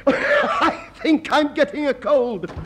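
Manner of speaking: high, slow, laughing through the words. Acted. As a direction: laugh the complaint out. Ends his rant in laughter.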